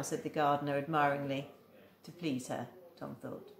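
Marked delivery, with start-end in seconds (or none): none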